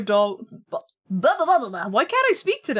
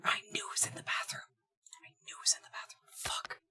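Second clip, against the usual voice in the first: whispering; 4 semitones higher, 18 dB quieter, faster than usual. Real, not acted. Searching the bathroom for key.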